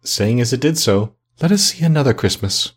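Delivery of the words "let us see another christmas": higher, lightly voiced ghost; Spirit's thoughtful voice. A narrator's reading. The ghost waves a hand to Scrooge, higher thoughtful voice. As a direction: gently raise the pitch for the spirit. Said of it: "The ghost smiled thoughtfully".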